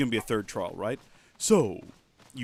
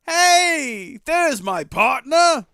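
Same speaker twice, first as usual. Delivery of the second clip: much higher and louder, slow hearty call; booming friendly partner; 11 semitones higher, 8 dB louder than usual. Acted. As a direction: greet your old partner boisterously. New character greets loudly.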